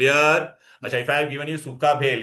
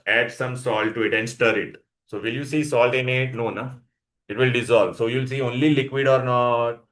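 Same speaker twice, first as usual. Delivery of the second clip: usual speaking voice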